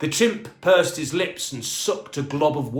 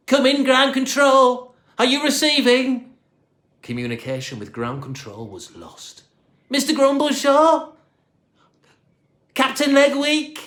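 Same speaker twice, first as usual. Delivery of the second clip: high, loud radio calls; calling out; 11 semitones higher, 6 dB louder than usual. A narrator's reading. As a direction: call into the silent radio, worried. Calling over dead radio.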